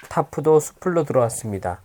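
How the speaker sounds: plain reading voice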